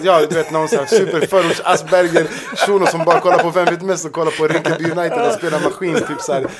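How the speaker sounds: cracking up at the persona; laughing. Real, not acted. Describing his fake quiz persona to friends, laughing.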